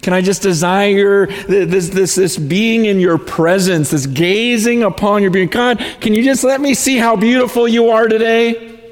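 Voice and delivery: high and loud, pouring out longing; fervent prayer. Retold or imitated. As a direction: plead with God, swept up and passionate. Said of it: "I've been saying".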